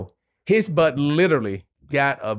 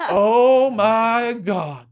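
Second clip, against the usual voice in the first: stunned disbelief; 10 semitones higher, 5 dB louder, much slower than usual. Retold or imitated. His name called before crowd.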